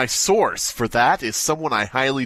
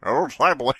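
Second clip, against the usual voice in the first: slow, high put-on voice introducing himself; a hint of a creepy narrator; mock Creeps McPasta; 7 semitones higher, slower than usual. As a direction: play the creepypasta author, hammed-up. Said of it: "It's me".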